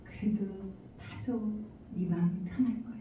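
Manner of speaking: quiet, soft, tender reply; gentle voice of God. Retold or imitated. God answering the hurt wife, soft and tender. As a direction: speak it softly like a gentle father. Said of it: "하나님께서".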